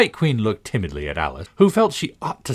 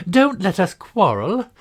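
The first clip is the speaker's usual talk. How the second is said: anxious tone; 13 semitones higher, 5 dB louder, slower than usual. A narrator's reading. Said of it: "said in an anxious tone".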